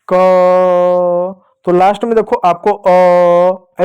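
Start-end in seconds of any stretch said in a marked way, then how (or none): 0.1-1.5 long, sustained "क"; drawn-out क. Shown as a demonstration. Teacher has pupils repeat to hear the hidden vowel, drawn-out क. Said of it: "क का उच्चारण करो, बोलो". 2.7-3.8 long held "अ" like the tail of क; drawn-out अ. Shown as a demonstration. Teacher isolating the sound heard at the end, drawn-out अ. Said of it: "ऐसे करते हुए ध्वनि सुनाई दे रही है".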